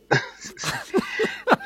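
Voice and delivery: high, loud burst of laughter; big amused laugh; real laugh. Real, not acted. Caller laughs at her own answer.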